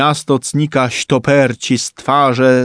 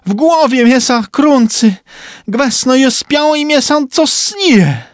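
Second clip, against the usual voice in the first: panicked shout; 11 semitones higher than usual. A narrator's reading. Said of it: "krzyknął trumba".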